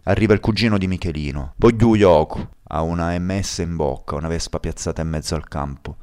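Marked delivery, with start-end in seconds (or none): 1.6-2.5 shout. Acted. Cousin demands loudly.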